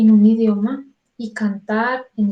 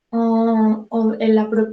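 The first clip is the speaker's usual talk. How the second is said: normal talking voice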